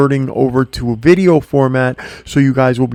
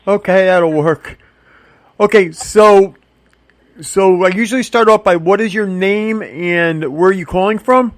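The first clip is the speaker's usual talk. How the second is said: louder and higher for a bad line; speaking up; 7 semitones higher, 3 dB louder than usual. Real, not acted. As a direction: raise your voice so the caller hears. Caller can barely hear him.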